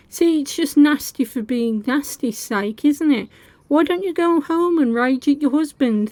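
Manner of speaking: usual speaking voice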